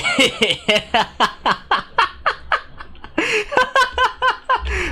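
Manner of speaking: shrieking helpless laughter. Real, not acted. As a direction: laugh until the voice goes squeaky. Uncontrollable laughter at glitch.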